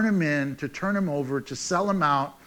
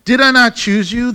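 Jesus' stern question; 5 semitones higher, 9 dB louder than usual. Retold or imitated. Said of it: "And Jesus answered them".

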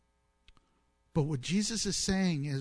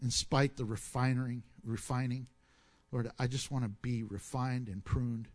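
closing prayer; 4 semitones lower than usual. Acted. Pastor closes in prayer.

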